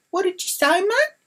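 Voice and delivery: very high indignant question; retold challenge. Confronting the man who swore at her, indignant challenge. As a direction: confront him, voice shooting up.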